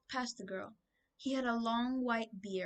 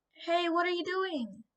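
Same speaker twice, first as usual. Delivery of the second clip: high startled yell; boy yelling as he's grabbed; 8 semitones higher than usual. A narrator's reading. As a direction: yell in alarm, pitched up. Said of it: "Drake yelled".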